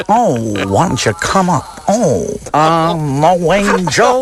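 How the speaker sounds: slow, sultry screen-siren drawl; Mae West impression. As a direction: purr it like a vamp. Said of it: "It's Mae West".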